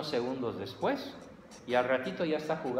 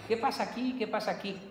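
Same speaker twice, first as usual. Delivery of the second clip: dad checking in; 9 semitones higher, faster than usual. Retold or imitated. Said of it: "el papá, medio rasurar, se asoma".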